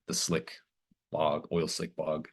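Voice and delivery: usual speaking voice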